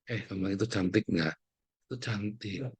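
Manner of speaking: put-on soft coaxing to child. Parent teaching child about beauty.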